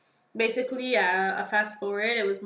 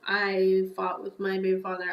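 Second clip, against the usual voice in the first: ordinary delivery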